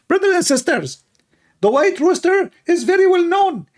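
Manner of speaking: high mocking preacher voice; squeaky sermon mimicking a preacher; Ali Dawa. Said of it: "Let us ask Ali Dawa why".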